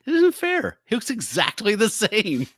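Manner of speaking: high, slow whine; whiny complaint. Acted. Velcro whining to the doctor, high and drawn out. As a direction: complain like a sulky kid. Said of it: "it's like".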